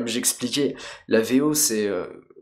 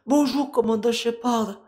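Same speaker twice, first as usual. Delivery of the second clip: put-on Eve, southern accent; 13 semitones higher than usual. Krogan woman confiding in commander, high southern French accent. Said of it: "un petit accent du sud".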